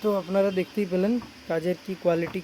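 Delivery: normal talking voice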